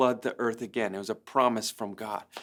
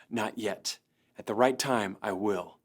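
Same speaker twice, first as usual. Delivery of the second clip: low, soft voice of God in his head; calm divine reply, lower and softer; 5 semitones lower, 6 dB quieter than usual. Retold or imitated. Pastor voicing God's answer to his prayer, low and soft.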